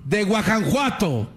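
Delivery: high, slow orator proclaiming to the people; rally the crowd like a priest-hero; mock heroic proclamation, high and drawn out; rousing speech. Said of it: "se pone a gritar".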